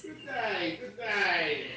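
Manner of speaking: soft, prim queen's greeting; a hint of a haughty queen; mock posh British greeting. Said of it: "The queen has arrived".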